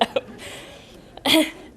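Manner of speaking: breathy nervous chuckle; a shy, nervous little laugh. Real, not acted. Laughs after nervous accent remark.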